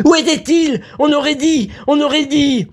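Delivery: high, panicked stammering outcry; frantic high-pitched bewilderment; crying out. A narrator's reading. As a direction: blurt it out in a very high alarmed voice. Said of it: "s'écria Vera".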